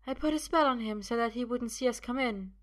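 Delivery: high, composed confession; matter-of-fact enchantress. Acted. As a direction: explain the spell assuredly, pitched high. Sophia explains her spell.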